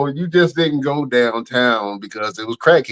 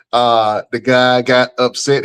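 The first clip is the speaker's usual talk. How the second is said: usual speaking voice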